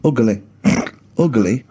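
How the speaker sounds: Ugly as UG-uh-lee, an extra syllable in the middle; mock three-syllable ugly. Host mocking Carl's extra syllable, saying Ugly as ug-uh-lee. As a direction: stretch Ugly into ug-a-ly like Carl. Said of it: "He gets an extra syllable in the word ugly".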